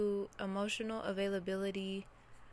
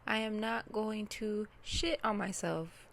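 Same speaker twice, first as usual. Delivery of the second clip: ordinary delivery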